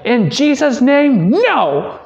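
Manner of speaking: hammed-up high-pitched rebuke; imitating Mrs. Bieber's rebuke. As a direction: shriek it out like a fierce praying teacher. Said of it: "in true fashion only that Missus Bieber could do".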